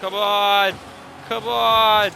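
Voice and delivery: loud, high exasperated "come on"; frustrated yell. Real, not acted. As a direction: groan "come on" at a teammate. Yelling at a teammate in game.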